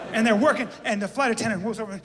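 usual speaking voice

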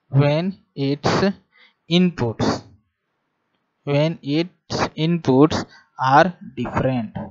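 each word drawn out slowly for note-taking; slow classroom dictation with pauses. Acted. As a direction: dictate it word by word so students can write.

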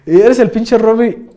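loud and slow, stretching each word; slow loud gotcha; triumphant call-out. Retold or imitated. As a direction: rub it in, you caught him. Repeats the gotcha line.

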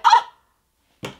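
short, loud mock gasp; startled gasp. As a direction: gasp as if walking in on them. Walks in on the couple.

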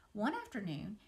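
usual speaking voice

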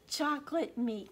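put-on lively read-aloud for kids; animated storybook narration; story reading. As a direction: read it like a picture book to children. Reads a picture book aloud.

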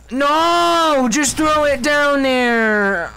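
high, long drawn-out wail; exasperated cry at the game; frustrated wail. Real, not acted. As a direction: whine it out slowly, falling away.

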